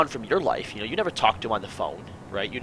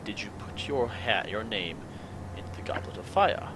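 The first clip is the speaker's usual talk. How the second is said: calm whisper; 4 semitones lower, 9 dB quieter than usual. Retold or imitated. Quoting Dumbledore's book line to Harry, calm and hushed. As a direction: murmur it gently, trailing into a whisper. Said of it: "or says calmly".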